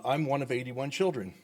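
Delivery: ordinary talk, nothing marked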